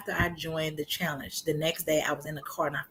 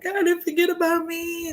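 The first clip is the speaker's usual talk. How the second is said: crying; 12 semitones higher than usual. Retold or imitated. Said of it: "I'm just crying like".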